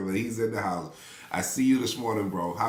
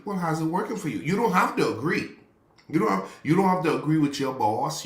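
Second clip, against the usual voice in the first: higher, louder preaching climax; preaching at full force; 7 semitones higher, 5 dB louder than usual. Acted. Pastor preaching to the congregation, rising and louder. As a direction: drive it home like a pulpit climax.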